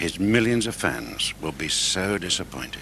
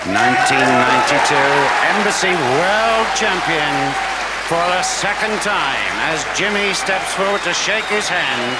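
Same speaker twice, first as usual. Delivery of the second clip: excited commentary; 8 dB louder than usual. Real, not acted. Champion crowned over applause.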